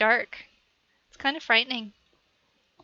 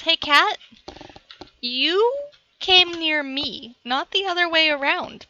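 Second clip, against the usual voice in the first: louder and higher mock scolding of the cat; 5 semitones higher, 6 dB louder than usual. Real, not acted. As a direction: tell the cat off, half-playful and loud.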